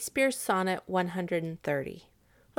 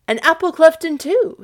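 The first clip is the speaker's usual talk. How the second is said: high, loud exclamation; amazed onlooker; 9 semitones higher, 8 dB louder than usual. Acted. Sebastian's acquaintance seeing the twins, amazed exclamation. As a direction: marvel aloud, pitched up.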